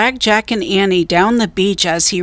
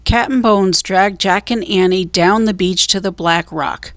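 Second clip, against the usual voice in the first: plain reading voice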